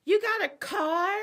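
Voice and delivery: high, slow whiny mock of her asking; mocking girlfriend voice. Girlfriend mocking boyfriend about his car, whiny and high. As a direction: squeal it out like a needy girlfriend. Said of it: "she's like".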